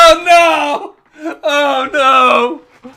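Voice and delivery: loud, high laughing cry; laughing dismay. Real, not acted. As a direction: cry out in dismay while cracking up. Reacts to what was sent.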